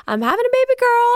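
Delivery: mom's excited announcement, high and loud, fast. Retold or imitated. Mother telling everyone about the baby, in an excited high voice. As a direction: burst out the news like a thrilled mom-to-be. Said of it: "starts telling everyone".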